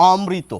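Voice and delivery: অমৃত as o-mri-to, single m; trained reciter's অমৃত, open অ before mri; careful formal pronunciation. Shown as a demonstration. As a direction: say অমৃত formally, m not doubled. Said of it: "চেষ্টাকৃত".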